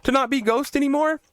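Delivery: high, baffled mock question; incredulous. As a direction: act out disbelief at the kids. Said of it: "And I'm like".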